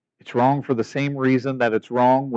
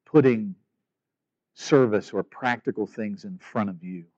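soft, earnest closing prayer; 5 semitones lower, 3 dB quieter than usual. Acted. Pastor praying over his congregation at the end, soft and earnest. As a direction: pray quietly over the church.

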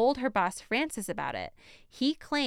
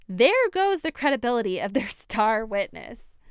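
ordinary delivery

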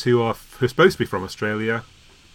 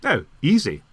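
ordinary talk, nothing marked

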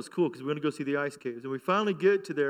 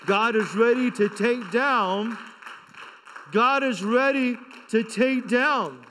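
slow, louder, higher declaration repeated; building preaching; 6 semitones higher, 3 dB louder, slower than usual. Real, not acted. Pastor slowing down to proclaim it to the church. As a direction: drag it out, rising with conviction.

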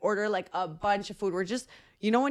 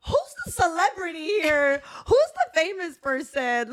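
diva sarcasm; 7 semitones higher, 3 dB louder than usual. Retold or imitated. Mock diva quote to DJ.

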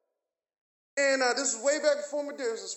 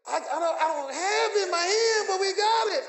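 higher, louder elated reply; excited shouting; 7 semitones higher, 7 dB louder than usual. Retold or imitated. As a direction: answer in a joyful yell. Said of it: "I was shouting".

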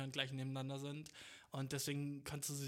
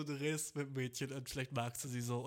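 normal talking voice

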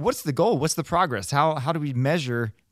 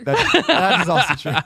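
laughing through the words; chuckling as you agree; 6 semitones higher, 5 dB louder than usual. Real, not acted.

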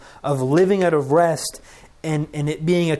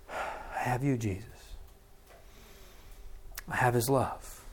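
softer, hushed words breathed out; quiet prayer; 3 semitones lower, 11 dB quieter than usual. Shown as a demonstration. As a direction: pray it gently, as if exhaling. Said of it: "I breathe it in".

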